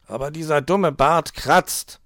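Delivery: a fox grumbling about the itchy beard; complaining. A narrator's reading. Herr Fuchs complains about the itchy beard, whiny. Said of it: "beschwerte er sich".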